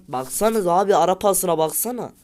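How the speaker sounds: loud, high, fed-up yell; exasperated shout. Real, not acted. Gamer yelling to viewers about the pass. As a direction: call out the through pass in disbelief.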